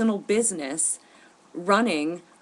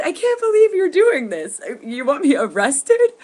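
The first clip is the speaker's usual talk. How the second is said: much higher and louder, incredulous; outraged disbelief; 9 semitones higher, 8 dB louder than usual. Retold or imitated. Confronting the congressman who wants him arrested, outraged disbelief. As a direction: let the outrage crack upward.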